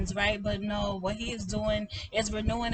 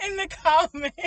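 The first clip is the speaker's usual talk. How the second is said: laughing; 11 semitones higher, 9 dB louder than usual. Real, not acted. Laughs at forgetting her words.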